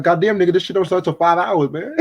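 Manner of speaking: mock outrage at someone way too early; exasperated disbelief. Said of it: "I'm like".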